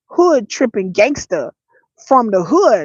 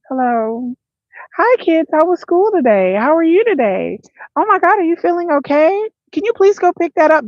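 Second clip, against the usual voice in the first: higher, sweet sing-song mom voice; 6 semitones higher than usual. Shown as a demonstration. Mother asking her kids about school, sweet and high. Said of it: "That's how I talk to my kids".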